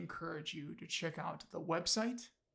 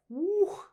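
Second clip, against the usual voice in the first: excited woo; 12 dB louder than usual. Real, not acted. Overwhelmed by the story.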